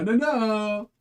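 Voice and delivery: high, loud laughing no; real laughing protest, high and loud. Real, not acted. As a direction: cry out in mock dismay at the pull. Reacting to a bad pull.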